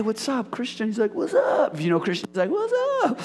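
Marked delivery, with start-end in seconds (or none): 1.1-1.8 high-pitched exuberant greeting. Retold or imitated. Pastor imitating his skateboarder friend's greeting, high-pitched impression. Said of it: "that's how he talks". 2.5-3.2 very high, exaggerated greeting. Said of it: "that's how he talks".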